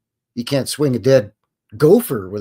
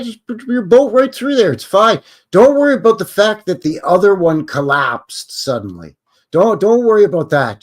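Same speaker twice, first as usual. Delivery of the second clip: higher, louder sarcastic reassurance; mock reassurance; 6 semitones higher, 7 dB louder than usual. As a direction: brush off the danger with mock confidence. Mock reassurance about risky boating.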